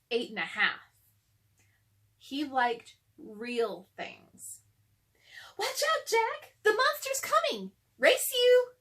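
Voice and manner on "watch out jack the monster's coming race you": put-on high, loud gleeful girl's shout; Annie calling out. A narrator's reading. Little sister daring brother to race, high gleeful shout. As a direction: tease him with a big high call.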